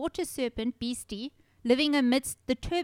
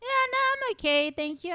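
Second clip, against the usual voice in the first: high-pitched, singsong brush-off; mock polite refusal; 12 semitones higher, 4 dB louder than usual. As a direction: breezily decline in a much higher voice. Acts out politely declining monster hunt.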